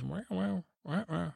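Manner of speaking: high, odd little cat voice, a touch mocking; Sir Pounce the cat. The cat reacts to the stable boy's boast with a high, mock wow. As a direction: play the cat going wow, pitched high. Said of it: "And Sir Pounce says".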